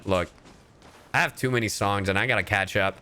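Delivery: normal talking voice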